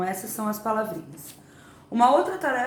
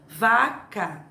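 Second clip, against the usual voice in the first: "vaca" slowly split as va, ca; "vaca" with a gap after va; split into syllables. Shown as a demonstration. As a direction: separate the two syllables of "vaca" for pupils. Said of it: "eu preciso separar a palavra vaca".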